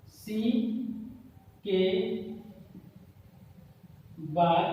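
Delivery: halting dictation with long pauses between words; spaced out for note-takers. Real, not acted. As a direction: dictate it one word at a time. Dictates word by word for writing.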